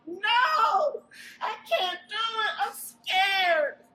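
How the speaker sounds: put-on high, loud, tearful child's scream of fear; scared scream. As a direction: cry out in panic, refusing to go. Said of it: "Larry screamed out".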